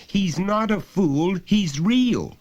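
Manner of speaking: put-on slow, high, insistent child; passionate. Boy defending his friend, passionate. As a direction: insist with all your heart.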